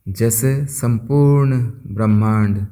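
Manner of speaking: soft, unhurried voice with long pauses; guided meditation. Acted. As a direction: guide listeners gently into stillness, phrase by phrase. (शांत, धीमे स्वर में ध्यान कराते हुए)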